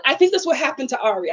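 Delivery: ordinary talk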